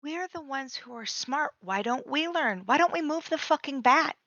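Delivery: usual speaking voice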